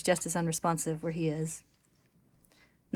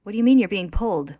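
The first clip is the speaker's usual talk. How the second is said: louder, mildly anxious question; 3 semitones higher, 12 dB louder than usual. A narrator's reading. Narrator voices Winry questioning her collapsing friend, anxious. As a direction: ask it with urgent worry, as a narrator. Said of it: "Winry asks anxiously".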